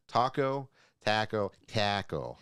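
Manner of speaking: taco said slowly with long Minnesota vowels; Minnesota accent taco. Shown as a demonstration. Guest trying to find her Minnesota accent, saying taco slowly. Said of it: "I have to say it twice to get to the accent".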